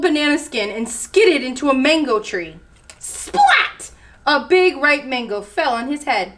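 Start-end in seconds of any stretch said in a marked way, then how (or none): 3.0-3.9 very high-pitched splat; sudden splat. A narrator's reading. Narrator sounding a mango landing, high and sudden. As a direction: pop it out high, like an impact. Mango lands on chameleon's head.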